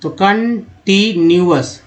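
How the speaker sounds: Continuous spoken slowly, syllable by syllable; slow model word. Shown as a demonstration. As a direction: stretch out continuous for learners. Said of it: "C O N को कन बोलते हैं". (Continuous धीरे-धीरे, शब्दांश अलग करके)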